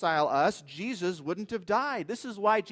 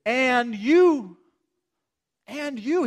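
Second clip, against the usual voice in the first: "and YOU", driven out with full emphasis; emphatic; 7 semitones higher, 11 dB louder, much slower than usual. Acted. Preacher stressing the verse's first words to his congregation, emphatic. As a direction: hit AND YOU hard, much louder and higher. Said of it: "I love how emphatic this is".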